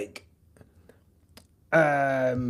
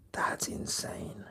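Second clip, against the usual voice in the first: whisper; 14 dB quieter, slower than usual. Real, not acted. Muttered reaction to chat.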